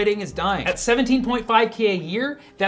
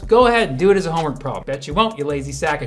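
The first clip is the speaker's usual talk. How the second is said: fast taunt; 4 semitones lower, faster than usual. Acted. Taunting the data trolls.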